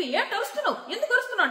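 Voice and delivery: rising mock yell through laughter; mock shout. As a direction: laugh while hamming up an angry yell. Prithvi confronting the shouter.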